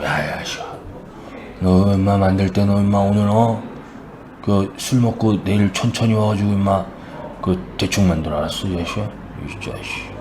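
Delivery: low, soft tough-guy muttering; imitated low-voiced shady boss; gruff backroom order. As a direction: keep it down and lean on them casually. God covertly leans on helmet maker.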